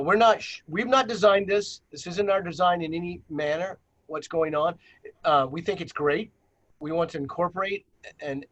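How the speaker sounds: usual speaking voice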